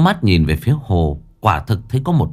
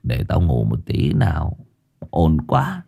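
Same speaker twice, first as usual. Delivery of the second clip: put-on low groggy drunken grumble; drunk and sleepy; 5 semitones lower, 5 dB quieter than usual. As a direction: grumble half asleep and drunk. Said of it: "vẫn còn đang trong cơn say". (giọng say ngái ngủ)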